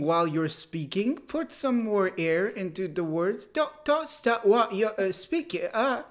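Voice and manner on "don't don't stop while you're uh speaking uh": higher, choppy British caricature; halting British mockery. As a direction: break the British phrase with needless stops. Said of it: "Now it's getting to offensive".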